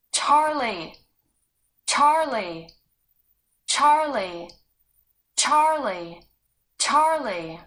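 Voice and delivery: loud, high calls, easing a little at the end; calling out. Acted. Sarah calling Charlie again and again, loud calls. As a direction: call his name over and over. Repeated calls for lost brother.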